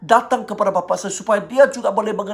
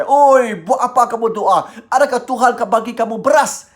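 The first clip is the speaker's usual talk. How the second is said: raised, loud mock telling-off; angry father scolding; 3 semitones higher, 4 dB louder than usual. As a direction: imitate a father berating his praying child. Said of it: "dia marah saya".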